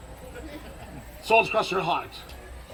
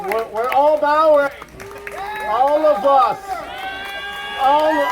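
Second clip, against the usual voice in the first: loud, higher corrective call; 6 semitones higher, 5 dB louder than usual. Real, not acted. Performer yelling at the children that they all bow, loud and higher. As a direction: bellow the correction across the stage. Coach shouts at bowing kids.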